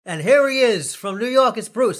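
high, loud, hammed-up British accent; bad British accent. British guest introducing Bruce on the show, bad British accent. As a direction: put on a loud, badly faked British announcer. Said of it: "I can't do the British accent very well".

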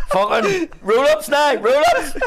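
high, slow grumbling monkey voice. Retold or imitated. Monkey complaining to the zoo crowd, high and slow. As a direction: moan it like a put-out monkey. Voicing the monkey on roll-ups.

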